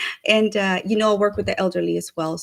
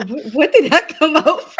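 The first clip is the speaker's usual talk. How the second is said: high loud laugh through words; 8 semitones higher, 8 dB louder than usual. Real, not acted. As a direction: crack up mid question. Bursting out laughing.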